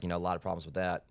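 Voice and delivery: usual speaking voice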